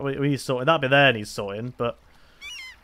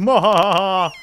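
evil cackle; 6 semitones higher, 9 dB louder, slower than usual. Pretend. Villain laugh after commands.

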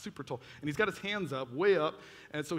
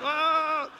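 loud, high mock cry; overwhelmed cry; 11 semitones higher, 8 dB louder than usual. Teen overcome while faking prayer, mock overwhelmed cry.

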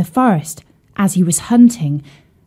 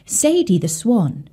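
ordinary delivery

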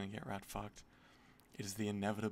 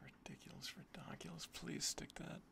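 whispered, breathless disbelief; tense whisper; 4 semitones higher, 9 dB quieter than usual. Real, not acted. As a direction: whisper it, hoping it lands. Tense whisper during opening.

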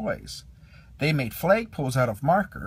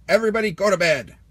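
fast, louder barked order; Sarge ordering fast; 5 semitones higher, 6 dB louder, faster than usual. A narrator's reading. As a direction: rattle off the command. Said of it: "he announced".

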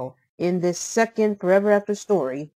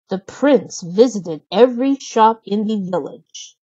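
usual speaking voice

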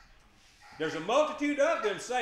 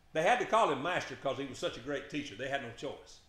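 ordinary delivery

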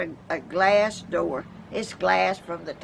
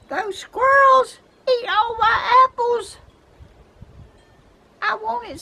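much higher, louder outraged wail about the squirrels; exasperated complaint; 7 dB louder than usual. Real, not acted. Telling viewers squirrels stole her apples, high exasperated wail. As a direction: cry out high and loud, half laughing at the loss.

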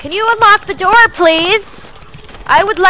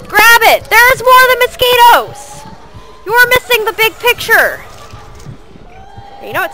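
usual speaking voice

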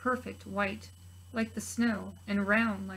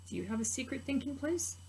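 softer, higher, inviting; 4 semitones higher, 6 dB quieter than usual. Real, not acted. Teacher to preschoolers, gentle question. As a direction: ask the little ones warmly. Asks the children directly.

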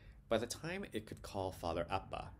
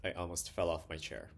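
ordinary delivery

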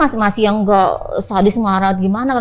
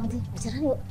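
soft proposal; 11 dB quieter than usual. Retold or imitated. Nephew's proposal repeated.

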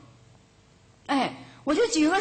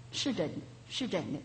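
much softer, quick reassuring murmur; softly and quickly confirm it; soft confirmation; 5 semitones lower, 10 dB quieter, faster than usual. Retold or imitated. Master nods, quiet confirmation. (师傅轻声快速地肯定)

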